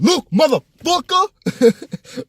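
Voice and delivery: high, drawn-out mock fury; imagined outburst. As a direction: stretch it into a hammed-up furious outburst. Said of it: "Not like that, but in my head".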